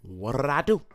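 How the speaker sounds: lightly voiced sassy character; a hint of the Mad TV lady; Bunifa's catchphrase. Imitating Deborah Wilson's Bunifa for a friend, lightly voiced. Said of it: "And then she'd be like".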